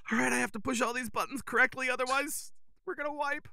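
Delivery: small high-pitched voice, quick at first; imitated tense focus. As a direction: mimic a player nervously concentrating under pressure. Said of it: "Very focused".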